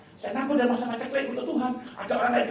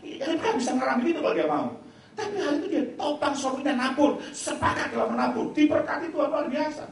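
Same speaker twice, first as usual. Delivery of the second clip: forceful preaching; 5 semitones higher, 7 dB louder than usual. Acted. Opening exhortation to the congregation.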